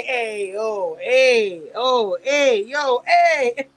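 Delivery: lightly mocked street-corner greeting; Pooch. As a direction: call out a repeated mock "ayo", a little drawn out. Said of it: "If he come from the corner and say".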